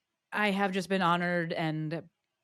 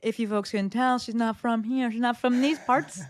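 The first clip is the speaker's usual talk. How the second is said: playful teasing; 6 semitones higher, 6 dB louder than usual. Real, not acted. Host teasing about guest's accent.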